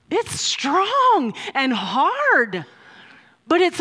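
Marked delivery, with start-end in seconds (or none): 0.1-2.7 slow, with "strong" and "hard" drawn out; stress on strong, hard. Real, not acted. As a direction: lean long and heavily on "strong" and "hard". Contrasts love's strength with softness.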